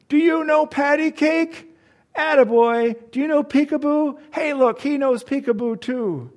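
put-on high, loud baby-talk calls; a tipsy old man hollering games at a baby; shouting drunk. A drunk shouts across the restaurant to baby Eric, loud and high.

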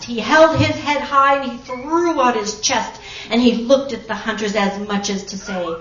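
ordinary delivery, nothing marked

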